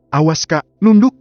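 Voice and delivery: high, urgent warning cry; loud shriek. A narrator's reading. Sister warns her sibling to duck, shrieking loudly. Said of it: "sambil memekik keras".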